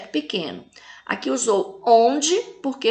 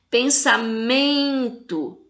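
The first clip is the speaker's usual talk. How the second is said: "Pensamento" slowly, MEN held long; teacher's drawn-out stress on MEN in "Pensamento"; tonic syllable stressed; much slower than usual. Shown as a demonstration. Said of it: "fala com mais intensidade".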